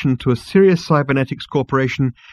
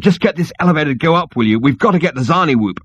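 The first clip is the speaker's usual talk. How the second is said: hurried, higher-pitched order; fast, pressing demand; urgent; 5 semitones higher, 3 dB louder than usual. A narrator's reading. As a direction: rattle it off impatiently. Zaphod urgently orders Marvin.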